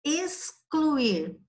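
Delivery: "excluir" with X sounded as a long S; teacher sounding out "excluir" for pupils to hear; pronunciation for listening. Shown as a demonstration. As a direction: raise the pitch and hold the S in "excluir". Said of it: "Agora escuta você aí".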